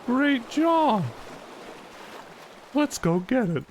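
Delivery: put-on high, cheery children's show host; kids TV host. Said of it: "I feel like I'm at a kid's T V show".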